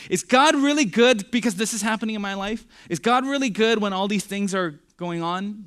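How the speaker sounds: loud, high, incredulous questioning; doubting. Acted. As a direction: voice a believer's protesting doubt. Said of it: "When you're doubting the goodness of God".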